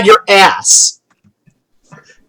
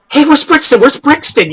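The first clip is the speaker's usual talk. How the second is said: higher-pitched eager questions; urgent calling out; 7 semitones higher than usual. Acted. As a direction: call out for a missing friend. Excited girls run up calling.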